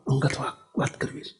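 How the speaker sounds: secretive whisper, fading to breath. Retold or imitated. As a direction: lean in and whisper the secret request. Student asks for private talk. (ધીમા અવાજે ખાનગીમાં ગુસપુસ)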